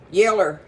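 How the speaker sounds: "Yeller" with an r at the end, YEL-ler; granny's country pronunciation. Retold or imitated. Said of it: "as my granny would say".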